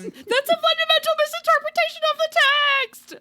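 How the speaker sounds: very high, loud shriek of protest; retold yelling. Podcast host re-enacts objecting to the adaptation, shrieked protest. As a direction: screech it like an outraged literary purist. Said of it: "I kept yelling things like".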